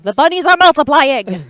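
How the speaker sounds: high, loud, excited cry; mock alarm. Commentator announcing the bunnies are multiplying, in mock alarm. Hyped call as rabbit tokens double.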